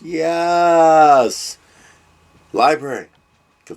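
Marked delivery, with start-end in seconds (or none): 0.0-1.6 loud happy cry; excited cheer. Real, not acted. Cheering on reading resolution.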